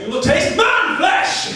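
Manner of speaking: put-on high, loud, slow little boy growl; high toddler battle cry. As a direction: chant it like a tiny orc. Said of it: "this kid".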